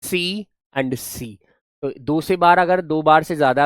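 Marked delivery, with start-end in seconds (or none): 0.0-1.4 very slow, drawn-out सी and सी; slow sound drill. Shown as a demonstration. Teacher slowly pointing out the repeated सी sound. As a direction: stretch out each सी to show it repeats. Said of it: "देखो सी".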